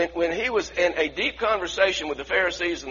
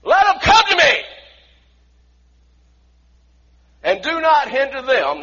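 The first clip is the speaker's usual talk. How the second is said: very loud, much higher shout; much more volume; 8 semitones higher, 8 dB louder than usual. Acted. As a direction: bellow it with full force. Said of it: "I think there was much more volume".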